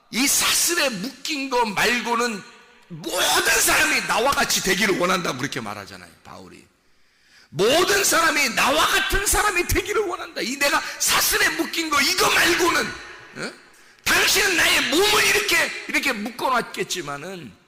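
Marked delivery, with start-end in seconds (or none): 0.1-5.4 lightly voiced apostle, raised and emphatic; Paul's bold appeal. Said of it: "바울이 뭐라 그래요?". 7.5-17.5 high, loud cry of the apostle Paul; impassioned preaching. Acted. Preaches Paul's words at full force.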